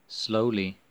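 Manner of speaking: plain reading voice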